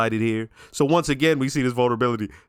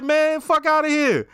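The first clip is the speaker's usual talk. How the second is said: defiant hotel owner; 13 semitones higher than usual. Retold or imitated. Hotel owner telling Tony off, in a high mock-tough voice. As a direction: squeak it out like a fearless old man brushing off threats.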